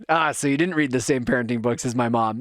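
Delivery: ordinary talk, nothing marked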